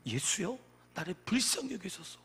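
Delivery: usual speaking voice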